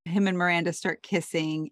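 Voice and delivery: usual speaking voice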